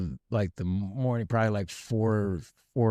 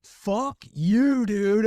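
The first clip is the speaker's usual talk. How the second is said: high, louder mock yell; much higher, louder angry jeer; friends' angry retort; 10 semitones higher, 3 dB louder, much slower than usual. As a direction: shout back at him in disgust. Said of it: "everyone's like".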